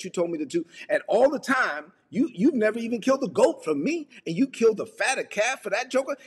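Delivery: sustained high-pitched indignant rant; acted resentful outburst; angry older son. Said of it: "the older brother was angry".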